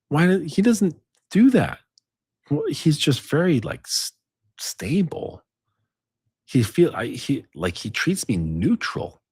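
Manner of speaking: put-on high, lightly girlish puzzled voice; mock puzzled woman. Man voicing a woman puzzled by a neutral guy, lightly high. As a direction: play her baffled by the neutral guy. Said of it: "And she's like".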